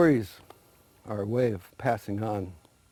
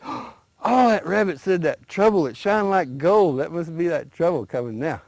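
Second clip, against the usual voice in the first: eager, higher voice seeing the flames; a lightly voiced, thrilled alligator; excited alligator; 7 semitones higher, 4 dB louder than usual. A narrator's reading. Alligator sees the fire coming, mildly excited and high. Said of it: "he got all excited".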